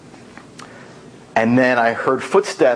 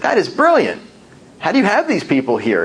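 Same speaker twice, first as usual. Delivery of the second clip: amazed; 6 semitones higher than usual. Retold or imitated. Meeting shadow president underground.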